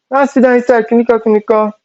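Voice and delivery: high-pitched mock telling-off; mom scolding. Mom telling her daughter to sit down, high mock scolding. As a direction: imitate mom's scolding way up high. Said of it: "She was like".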